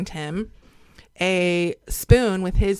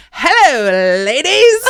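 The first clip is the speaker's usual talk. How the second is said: loud, drawn-out showy entrance, swooping up high; imitated grand greeting; 4 semitones higher, 9 dB louder, much slower than usual. Man greeting women as he opens the door, showy. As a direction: burst in hamming up a hello. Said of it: "opens the door and he goes".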